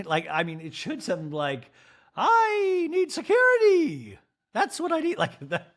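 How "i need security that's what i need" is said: robotic fussy pleading; Dorit robot voice. Retold or imitated. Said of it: "I do that voice for Dorit".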